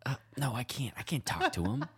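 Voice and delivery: mock timid whisper; scared whisper. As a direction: shrink away from the homeless man in a hush. Mocking scared passerby.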